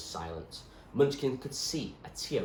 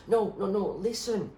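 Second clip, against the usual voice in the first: put-on higher, louder urgent girl; pleading girl; 13 semitones higher, 5 dB louder than usual. Zoe begging the doctor to remember, higher urgent voice. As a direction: insist desperately as Zoe.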